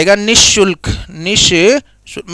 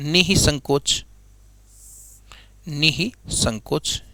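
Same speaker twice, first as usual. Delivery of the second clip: निसंकोच slow and much softer; slow, soft dictation; 6 semitones lower, 11 dB quieter, much slower than usual. Shown as a demonstration. Teacher to students, dictating the other spelling slowly. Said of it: "ऐसे भी लिख देते हैं".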